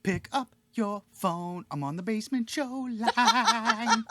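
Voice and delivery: slow, high, put-on caller voice. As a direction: act out an eager caller. Acting a caller to the hotline.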